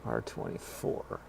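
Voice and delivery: slow unvoiced whisper. Real, not acted. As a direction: whisper the room slowly. Calling out room quietly.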